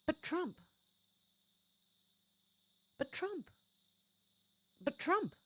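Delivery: a high, soft mock whine of "But Trump", over and over; sarcastic parroting, pitched well up; mocking Kamala. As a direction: mimic her dodging every question. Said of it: "All she's got is".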